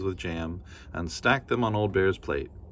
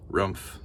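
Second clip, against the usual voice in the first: bear grunt; 4 dB quieter than usual. Acted.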